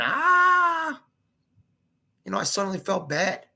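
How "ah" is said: high shrieked "Ah!"; retold scream. Narrator recalling brother screaming at August. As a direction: imitate the little brother's scream.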